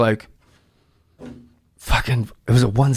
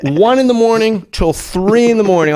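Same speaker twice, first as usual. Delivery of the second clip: exasperated emphasis; 6 semitones higher than usual. Real, not acted. Stressing how long the rewrite took.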